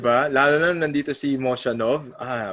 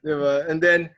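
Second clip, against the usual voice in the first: usual speaking voice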